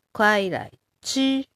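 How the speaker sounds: 快来ち said slowly and carefully as Mandarin kuài lái chī. Shown as a demonstration. Teacher drilling 快来ち for learners, slow and careful. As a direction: spell out 快来ち syllable by syllable in Mandarin. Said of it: "ちっていうのが食べる".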